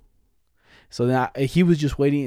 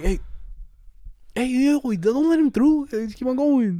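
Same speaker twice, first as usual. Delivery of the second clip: high-pitched slow holler at another driver; mock road-rage holler; calling out angrily; 11 semitones higher, slower than usual. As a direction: yell it out the car window, drawn out. Acting out yelling from car window.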